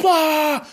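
high, loud mock scream of alarm; hammed-up yelp; panicked scream. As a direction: let out a sudden cry of panic. End of the imagined robbery scene.

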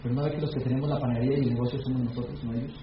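low, hushed fatherly reply; papá respondiendo. Retold or imitated. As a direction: voice a father quietly setting his son straight. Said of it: "Y mi papá dijo". (respuesta de papá, grave y bajita)

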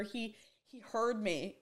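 ordinary delivery

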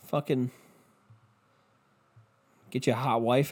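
ordinary talk

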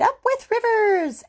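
very high, loud, joyful hoot. Acted. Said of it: "And they hooted".

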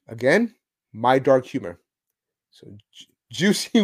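ordinary talk

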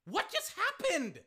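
high, slow cry of disbelief; bewildered Colts. Acted. Acting out the stunned Colts defense, high drawn-out bewilderment. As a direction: stretch it out, utterly baffled. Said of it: "the Colts is like".

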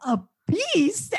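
mock indignation. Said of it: "Eileen being like".

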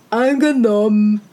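a lightly voiced sneering hare; scornful hare. A narrator's reading. The hare mocks the hedgehog's crooked legs, lightly sneering. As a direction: look down on him as a lightly voiced smug hare.

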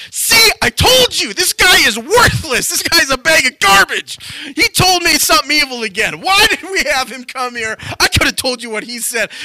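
high, loud tirade, easing toward the end; hammed-up furious complaint; outraged ranting. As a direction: rail at your ally in vindicated outrage. King rants to his ally.

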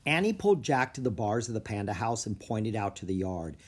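plain reading voice

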